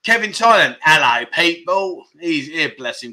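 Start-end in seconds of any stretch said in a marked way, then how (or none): 0.8-2.0 slow, stretched London greeting; exaggerated London accent. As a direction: draw it out in a mock London accent. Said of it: "Are you from London?".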